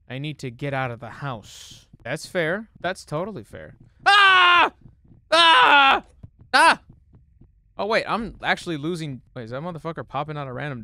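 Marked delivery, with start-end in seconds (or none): 4.0-6.8 high, loud scream; repeated yelps of fright, full volume; startled scream. Real, not acted. Player jump-scared by the game, screaming.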